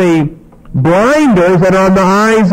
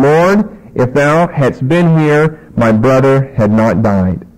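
slow, low reading from the Bible; scripture reading; 4 semitones lower, slower than usual. Acted. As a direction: draw out the verse with solemn weight. Reads scripture aloud.

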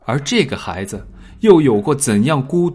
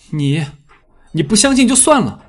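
hurt, defensive; 7 semitones higher, 3 dB louder than usual. Acted. Hurt boy still defending mother.

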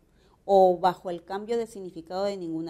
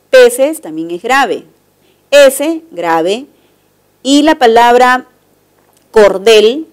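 loud exam read-aloud; 21 dB louder than usual. Acted. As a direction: read the answers out to the whole class. Reads the test out loudly.

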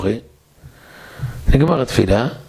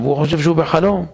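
ordinary delivery

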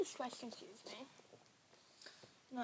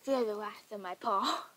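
loud, playful threat; mock battle cry; 9 dB louder than usual. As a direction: call it out like a cat warrior's threat.

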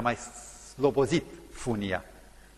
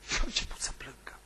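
unvoiced whisper; hushed narration; 6 dB quieter than usual. A narrator's reading. Preacher telling how the prisoner wept, whispered. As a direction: drop to a hush.

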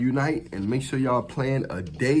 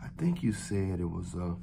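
low voice in the ear; 5 semitones lower, 8 dB quieter than usual. Shown as a demonstration. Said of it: "talk like that in the ear".

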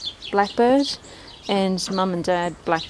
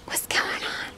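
whisper; 7 dB quieter than usual. Real, not acted. Whispering to duck.

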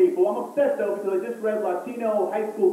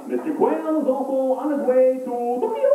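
newscaster in a put-on Japanese accent, a little higher; sing-song mock Japanese read; 4 semitones higher than usual. Broadcaster on the Tokyo trip, mock Japanese accent. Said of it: "they don't do this for the Japanese".

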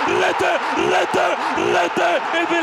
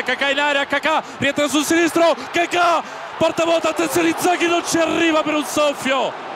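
excited near-miss call; 8 semitones higher than usual. Real, not acted. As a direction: shout the chance at the open goal.

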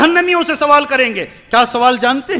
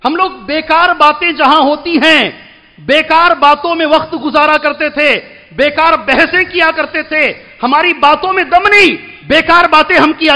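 raised, emphatic sermon voice; forceful preaching; 4 semitones higher than usual. Real, not acted. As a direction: drive the point home from the pulpit.

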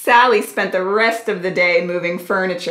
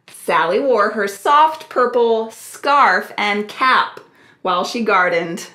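ordinary delivery, nothing marked

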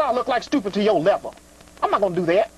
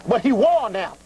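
normal talking voice

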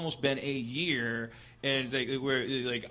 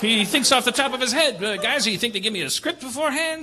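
loud, high, laughing Bogart imitation; a Bogart that drifts New York; Humphrey Bogart impression; 6 semitones higher, 8 dB louder than usual. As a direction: ham up a Bogart tough guy. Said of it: "your impression of Humphrey Bogart".

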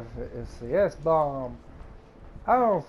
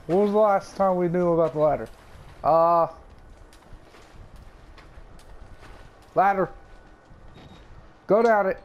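loud, high, frantic questions; panicked; 4 semitones higher, 6 dB louder than usual. Real, not acted. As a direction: scramble for the ladder in a panic. Panicked search for ladder.

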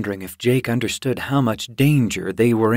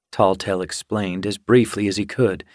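ordinary delivery

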